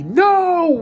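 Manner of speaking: high, loud cry of dismay; yelled. Acted. Captives yell as Boston hands over the light. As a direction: cry out in horror. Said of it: "we all yelled at once".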